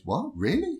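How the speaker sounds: high, rising disbelief in a retold reply; surprised. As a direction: act out being taken aback, pitch jumping up. Max reacts to being asked out.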